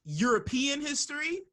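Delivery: high, pointed disbelief; mock-baffled European history; incredulous question. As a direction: ask it like he can't be serious. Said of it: "I'm like".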